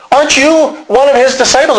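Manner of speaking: high, pointed accusing question; accusing questioner. Acted. Bystander at the fire challenging Peter, accusing. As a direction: pitch the challenge way up.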